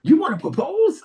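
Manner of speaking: high, rising disbelief; surprised host. Retold or imitated. DJ surprised the caller wants to propose. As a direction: act amazed at the news. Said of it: "She was like".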